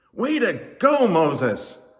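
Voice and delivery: high, loud crowd shout; shouted cheer. A narrator's reading. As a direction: shout praise to the hero. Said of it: "they all shouted".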